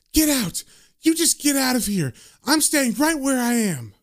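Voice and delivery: high-pitched indignant outburst; defiant order. Retold or imitated. Woman confronts the goons in her pub, high and defiant. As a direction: throw them out, pitch jumping high, acted.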